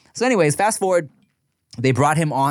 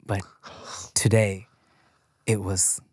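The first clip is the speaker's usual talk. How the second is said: halting, low, heavy-hearted confession to camera; sad retelling; 5 semitones lower, 4 dB quieter than usual. Retold or imitated. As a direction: act a mock-solemn farewell with long pauses. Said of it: "he's sad, his team is sad".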